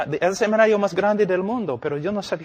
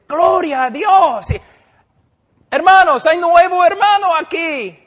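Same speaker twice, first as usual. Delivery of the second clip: mocking an overeager pastor; 12 semitones higher, 10 dB louder than usual. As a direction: parody the pastor who rushes to announce a convert. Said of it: "Y el pastor dice". (pastor exagerado, agudo y fuerte)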